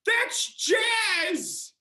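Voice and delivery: an indignant yell about jazz; indignant shout. Acted. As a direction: yell it as if it should be obvious. Son insists to mother.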